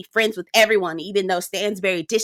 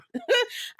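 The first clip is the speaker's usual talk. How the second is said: laugh; 12 semitones higher, 5 dB quieter than usual. Real, not acted. Laughs after rant.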